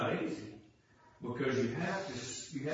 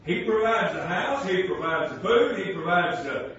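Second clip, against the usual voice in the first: loud, higher, pounding preaching; full-force preaching; 7 semitones higher, 8 dB louder than usual. Acted.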